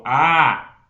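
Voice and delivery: sustained open "ah", mouth wide; open ah vowel. Shown as a demonstration. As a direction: hold a long open "ah". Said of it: "quando eu falo". ("ah" aberto e prolongado)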